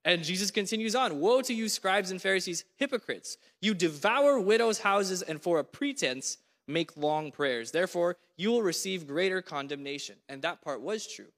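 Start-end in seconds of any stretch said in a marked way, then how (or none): 1.2-10.2 raised, forceful reading of Jesus' rebuke; preaching scripture. Acted. Preacher reading Jesus' woes to the congregation, forceful. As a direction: proclaim each woe, leaning on it.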